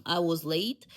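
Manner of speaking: normal talking voice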